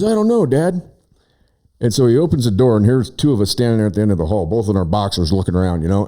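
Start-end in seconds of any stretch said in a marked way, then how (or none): 0.0-0.8 put-on young son. Voicing young son answering.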